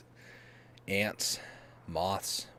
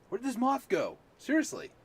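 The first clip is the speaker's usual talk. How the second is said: puzzled; 4 dB louder than usual. Real, not acted. Searching for moth.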